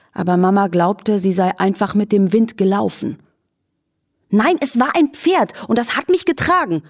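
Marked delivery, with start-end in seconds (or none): 4.3-6.8 hoch und empört, kindlich beharrend; protestierend. Acted. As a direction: energisch widersprechen. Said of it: "protestierte Lilli". (empört protestierend)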